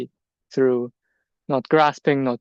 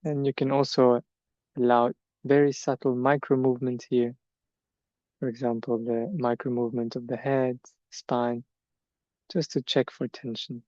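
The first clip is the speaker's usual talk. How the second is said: measured meditation guidance, long silences between phrases; guided meditation; 3 dB quieter than usual. Acted. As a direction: let each body part settle before the next. Leads standing meditation.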